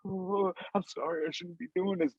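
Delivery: a soft mock-sob apology; mock crying. Co-host mimicking the crying Browns fan, soft mock-sob. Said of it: "he was just like".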